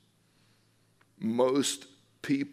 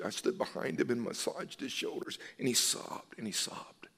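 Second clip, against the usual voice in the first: barely voiced, softer, tender; hushed storytelling; 6 dB quieter than usual. Real, not acted. Pastor telling the church about the sobbing husband, whispered. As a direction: let the grief pull it down to a whisper.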